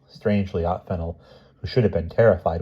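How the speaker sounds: usual speaking voice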